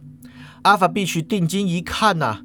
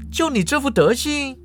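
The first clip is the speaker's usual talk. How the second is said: incredulous sneer; 8 semitones higher than usual. A narrator's reading. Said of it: "Alpha Beach不信".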